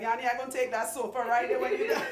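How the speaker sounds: fast, loud mock quote through a laugh; laughing imitation of client. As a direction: tease her words while laughing. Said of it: "She like".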